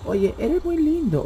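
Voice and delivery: lightly voiced teasing flirt; mock sweet-talk. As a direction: coo it mockingly. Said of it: "habla como hombre".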